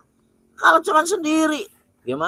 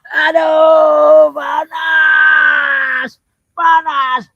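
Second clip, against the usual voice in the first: howling in pain; 4 semitones higher, 5 dB louder, much slower than usual. Acted. Amulet pressed on him.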